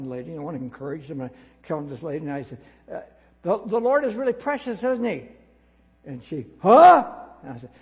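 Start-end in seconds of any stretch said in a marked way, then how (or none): 3.4-5.2 put-on loud and high, asking a deaf old lady cheerfully; raised cheerful call. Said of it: "and I don't shout". 6.6-7.0 deaf lady's huh. Retold or imitated. Deaf old lady not hearing.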